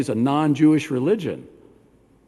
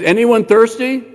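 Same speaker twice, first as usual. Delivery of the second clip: raised voice, higher, louder, calling to the crowd; 6 semitones higher, 6 dB louder than usual. Acted. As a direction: call it out to a thirsty crowd. Yeshua calling out at festival.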